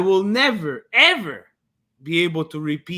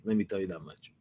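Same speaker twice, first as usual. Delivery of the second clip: whispered aside; 9 semitones lower, 9 dB quieter, much faster than usual. Real, not acted. Ominous aside after a win.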